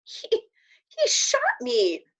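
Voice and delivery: high, slow stammering disbelief; stunned, can't believe it happened; shocked. Acted. Patrick telling Omri, still shocked, he was shot. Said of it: "in a shocked voice".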